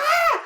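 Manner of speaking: very high squealing shriek; teenage fan scream. Retold or imitated. Women telling a visitor about meeting Johnny, squealing like teenagers. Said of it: "like, teenagers, right?".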